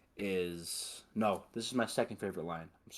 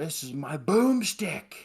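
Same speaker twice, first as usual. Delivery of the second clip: slow, louder and higher hammed-up hero line; Ash quote; 7 semitones higher, 6 dB louder, slower than usual. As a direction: proudly show off the boomstick, drawn out. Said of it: "He's like".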